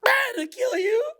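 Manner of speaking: high, crying shriek of pure hate; possessed screaming hatred. Retold or imitated. Programmed person turning on you, shrieking and crying. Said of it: "Like Matrix Smith".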